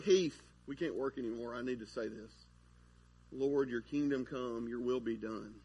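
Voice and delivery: soft, low aside turning to prayer; acted solemn prayer. Worker stopping a coworker to pray, acted low and soft. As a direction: drop the voice low, as if pausing to pray.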